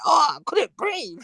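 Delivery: high-pitched mocking whine; mimicking, half laughing; mock distressed victim. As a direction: imitate a choked man's plaintive excuse. Mimics crying man who was choked.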